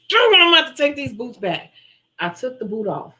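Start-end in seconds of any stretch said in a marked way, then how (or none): 0.1-1.7 higher, louder, fed up with the boots; frustrated outburst. Retold or imitated. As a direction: announce giving up in exasperation.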